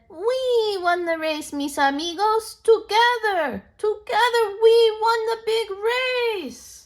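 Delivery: joyful victory. Acted. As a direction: share the win with friends. Toad celebrates victory.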